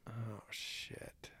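whispered curse under the breath; real hushed dismay. Real, not acted. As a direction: mutter it to yourself, barely voiced. Stuck guessing the year.

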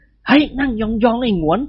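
plain reading voice

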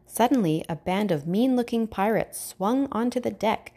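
ordinary delivery

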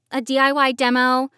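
normal talking voice

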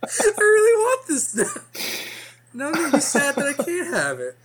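high, laughing lich screech; ancient lich voice. Acted. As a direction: whine it in the undead voice. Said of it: "all I can hear is Gilbert Gottfried now".

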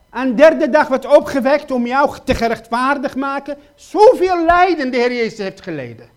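loud, urgent pleading sermon voice; preacher crying out his questions to the congregation, loud and pleading; full-force preaching. Acted. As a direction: hammer each question home from the pulpit.

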